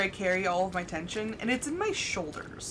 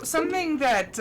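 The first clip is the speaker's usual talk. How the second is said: ordinary talk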